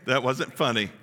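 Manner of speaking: usual speaking voice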